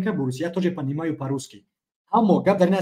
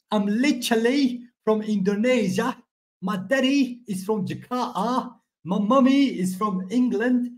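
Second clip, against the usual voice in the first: British accent; 7 semitones higher than usual. Shown as a demonstration. Joking to viewers that he speaks British English, mock British accent. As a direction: show off a playful British accent, higher than usual. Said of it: "Even I talk in British accent".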